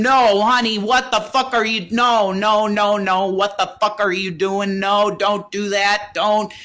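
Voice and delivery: high, loud panicked screams, slowing on the don'ts; screaming. Retold or imitated. Begging his partner not to swallow the pills, loud panicked scream. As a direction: yell at her to stop, terrified. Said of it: "And I screamed".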